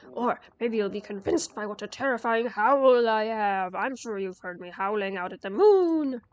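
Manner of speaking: loud growling wolf voice; big bad wolf. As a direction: boom it out like a fearsome beast. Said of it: "you're not really a wolf".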